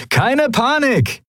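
high, drawn-out breezy holler; cheerful call. A narrator's reading. Sephiron to his cronies on arriving, calling out cheerfully. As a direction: sing out slowly in the best of moods. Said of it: "rief Sephiron seinen Kumpanen in bester Laune zu".

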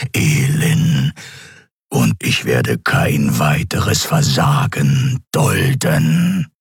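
very slow, low distorted emperor; menacing, warped dark lord. Acted. Emperor gives orders. (verzerrte, tiefe Herrscherstimme)

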